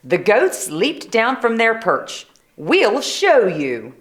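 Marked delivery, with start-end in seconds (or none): 2.6-3.9 slow goat voice. Acted. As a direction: drawl it out like a goat. Goats boast.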